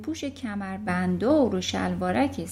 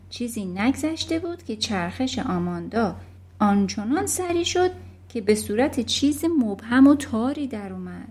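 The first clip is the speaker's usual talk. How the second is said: ordinary delivery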